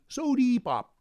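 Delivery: "Sody" with a high, strong EE ending; hard E in sody. Shown as a demonstration. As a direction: punch the EE in "Sody pop" upward. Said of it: "With a hard E".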